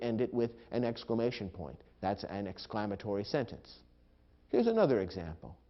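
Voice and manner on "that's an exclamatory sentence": usual speaking voice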